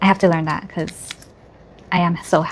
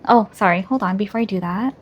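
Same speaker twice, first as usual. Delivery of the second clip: usual speaking voice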